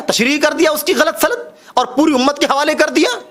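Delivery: ordinary talk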